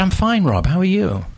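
high, overly bright greeting; forced cheerfulness. Retold or imitated. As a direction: sound fine while hiding pain. Pretends to be fine.

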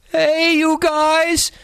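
high, slow hollered call; hollering. A narrator's reading. Boy calling to friends over the storm, high hollering. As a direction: shout over the wind. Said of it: "the first boy hollered over the cry of the wind".

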